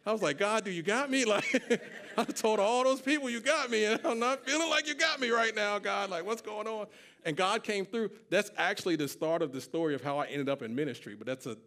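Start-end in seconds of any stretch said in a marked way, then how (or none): 0.4-6.9 much higher, half-laughing mock complaint; exasperated plea. Jobless believer questioning God, high mock-exasperated plea. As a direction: plead upward in comic panic.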